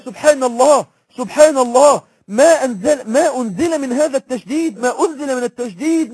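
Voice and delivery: loud, urgent exclamation of alarm; preacher booming the Prophet's awe; alarmed loud cry. Acted. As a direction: call it out as if struck by fear.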